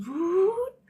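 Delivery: rising high whoop of the sun jumping a portal; a quick playful whoop. Retold or imitated. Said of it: "and he goes".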